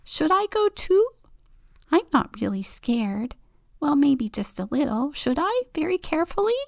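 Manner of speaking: nervous little bird asking. Acted.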